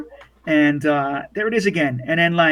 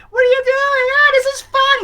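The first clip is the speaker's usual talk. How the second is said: put-on very high, loud child squeal; high-pitched boyish thrill; excited little boy; 5 dB louder than usual. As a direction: squeal like a kid thrown in the air. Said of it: "he's like".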